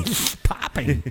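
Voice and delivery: slow, with popping p's. Shown as a demonstration. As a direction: spit the p's out slowly. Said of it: "Your mic was popping".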